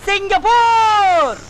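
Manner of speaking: very drawn-out, high announcement; showy drawl. Acted. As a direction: stretch the place name out grandly. Answers companion's question, showing off. (நீட்டி முழக்கும் குரல்)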